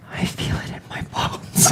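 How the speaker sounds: eerie whisper. Acted. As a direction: breathe it out, ominous. Ominous whisper about the chill.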